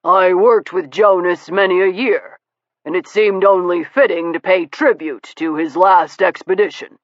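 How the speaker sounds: lightly voiced courteous American gentleman; a hint of a suave visitor paying respects; Steele's voice. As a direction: play him smooth and polite.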